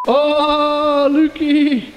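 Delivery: high, drawn-out happy squeal of a greeting; excited greeting call. Real, not acted. Visitor spots friend Luke, high excited greeting.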